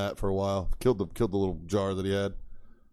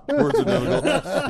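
laughing; 10 semitones higher, 7 dB louder than usual. Real, not acted. Joking about his own stumble.

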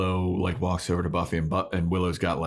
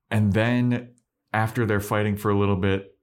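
ordinary talk, nothing marked

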